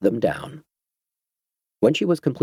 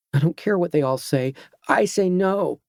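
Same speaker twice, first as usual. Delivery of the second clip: put-on defiant girl; 8 semitones higher than usual. Girl defies captain.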